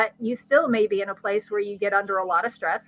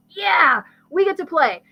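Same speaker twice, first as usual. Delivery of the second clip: high, loud, gleeful cheer; eager cheer; 7 semitones higher, 5 dB louder than usual. Acted. Adrenal glands finally get their turn, eager cheer. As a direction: cheer like players let loose.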